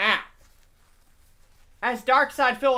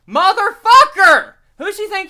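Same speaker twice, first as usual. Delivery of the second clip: loud outburst; 9 semitones higher, 11 dB louder than usual. Real, not acted. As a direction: shout the swear word out. Outburst about Leslie not sleeping.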